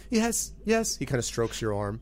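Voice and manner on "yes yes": high, pleased "Yes, yes"; a contented little goblin. Acted. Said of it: "he's like".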